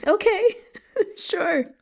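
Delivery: real giggle through the words; laughing. Real, not acted. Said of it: "reader laughing off a mistake".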